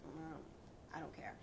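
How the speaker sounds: usual speaking voice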